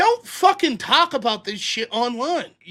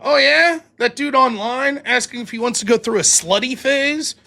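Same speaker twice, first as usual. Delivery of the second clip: high, loud mocking teen jeer; mocking comeback; 4 semitones higher, 3 dB louder than usual. Schoolkid mocks a classmate about his dad: loud jeer. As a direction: sneer it back at the bully. Acted kid quarrel, mocking reply.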